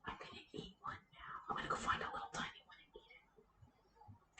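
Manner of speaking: hushed, breathy whisper. Real, not acted. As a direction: whisper it like a secret. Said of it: "Why are we whispering?".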